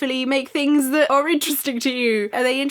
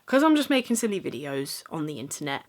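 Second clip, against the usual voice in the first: ordinary talk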